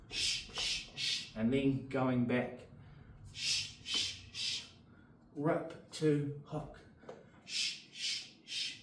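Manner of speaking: short hissed breaths on each punch; sharp punch exhales. Shown as a demonstration. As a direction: exhale sharply with every strike.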